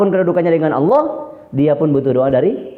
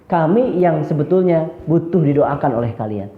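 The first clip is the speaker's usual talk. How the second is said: ordinary delivery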